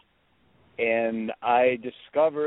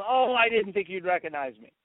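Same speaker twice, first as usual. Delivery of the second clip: higher-pitched imitation of mother; lightly voiced mom; 7 semitones higher than usual. Mother caught calling her son, lightly voiced mom. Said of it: "And she goes".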